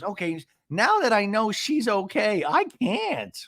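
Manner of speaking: high mock exasperation; Gina. Recapper mimics housewife bowing out, high mock exasperation. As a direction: give up in a high, put-on voice. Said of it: "And she goes".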